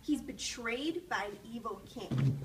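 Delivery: ordinary delivery, nothing marked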